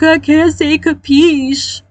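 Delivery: high, drawn-out mock wail; imitated crying child. Child held by the face answering father, mock crying. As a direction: plead slowly like a crying kid. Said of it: "I would start crying".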